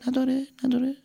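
high soft echo of the word; faint cave echo. Acted. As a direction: let it ring back softly. Echo fading.

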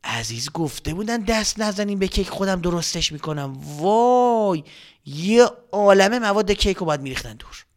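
plain reading voice

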